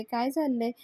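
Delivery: usual speaking voice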